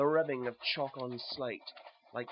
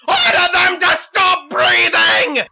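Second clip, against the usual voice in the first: screamed, drawn-out royal order; very high, full-force furious scream; ranting and raving; 23 dB louder, slower than usual. Acted. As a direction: shriek it like a maddened king. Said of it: "The king had ranted and raved".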